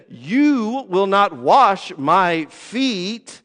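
slow, deliberate refusal with each word leaned on; emphatic Peter. Retold or imitated. Peter flatly refusing Jesus, slow and emphatic. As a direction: Peter digging in his heels, word by word. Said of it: "Peter is emphatic in it".

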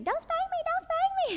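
very high mock-terrified child squeal; mock scared scream. Child running from angry parent, high mock-terrified squeal. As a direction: shriek like a kid fleeing a spanking.

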